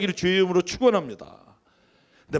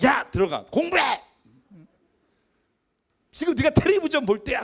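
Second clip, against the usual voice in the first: scolding yell; 8 semitones higher, 4 dB louder than usual. Retold or imitated. Parent yelling at child.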